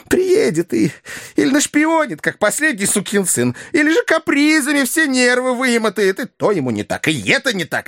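high, put-on complaining voice; fussy wheedling fixer. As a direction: pile up grievances in a high character voice. (суетливый ходатай, жалобный высокий голос)

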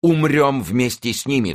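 high, heroic cry; impassioned cry. A narrator's reading. The king vows to die with them, high impassioned cry. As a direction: cry it out with desperate resolve. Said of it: "вскричал король".

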